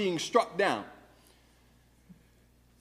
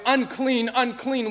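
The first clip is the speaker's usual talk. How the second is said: leper's cry; 6 semitones higher, 4 dB louder than usual. Acted. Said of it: "Behind the cry of".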